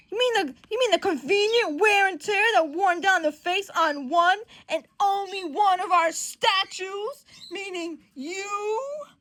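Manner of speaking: loud, high, slowing to a pointed finish; upset accusation. Acted. Johnny pointing the finger at Peter, high pointed accusation. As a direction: press the accusation, then stretch the last words. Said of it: "okay, Johnny's upset".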